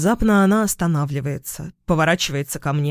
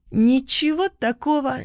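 put-on higher-pitched, light, tipsy-girl giggly read; mild laughing lilt without a real laugh; laughing line; 8 semitones higher than usual. A narrator's reading. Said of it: "смеётся она". (со смешком, высоким голосом)

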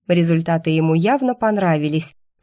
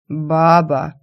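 slow lazy grunt of a chief; lazy barbarian chief; 4 dB louder than usual. A narrator's reading. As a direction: drawl it lazily like a brute. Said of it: "лениво поинтересовался вождь".

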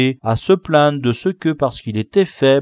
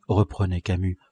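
plain reading voice

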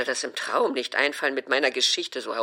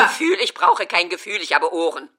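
higher, louder, scoffing; mother bristles at "feeling"; indignant outburst; 4 semitones higher, 7 dB louder than usual. Acted. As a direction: scoff at the word. Mother offended by "Gefühl.

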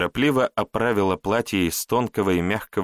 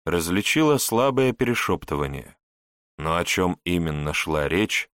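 ordinary delivery

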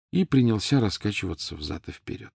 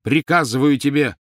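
loud, higher fierce shout of the name; 6 semitones higher, 6 dB louder than usual. A narrator's reading. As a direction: bark the elf's name in fury. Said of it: "свирепо произнёс Гарри". (свирепый громкий окрик)

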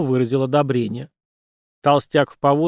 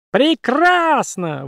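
high, very slow puppet exclaim; drawn-out squeak; 12 semitones higher, much slower than usual. Acted. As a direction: stretch it out squeaking. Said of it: "воскликнула кукла".

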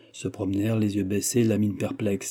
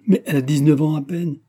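higher, louder astonished objection; exclaimed protest; 5 semitones higher, 6 dB louder than usual. A narrator's reading. Elder brother objects to a young bride, exclaimed protest. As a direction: cry it out in dismay at the idea. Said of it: "S'écria l'aîné, en joignant les mains".

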